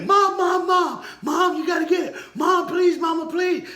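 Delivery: put-on high, loud, pleading kid voice; excited little boy. Little boy begging his mom for the Halloween costume, shouting high. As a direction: beg like a six-year-old who wants the costume.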